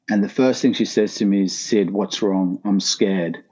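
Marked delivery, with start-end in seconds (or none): none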